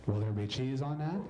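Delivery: low, flat drive-thru drone; bored drive-thru worker. Acted. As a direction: drone it like a tired order-taker.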